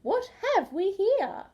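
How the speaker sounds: high, fluttery lady voice; genteel high-pitched voice; fussy lady emu. A narrator's reading. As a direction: play a prim, fussy bird. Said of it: "she fluttered".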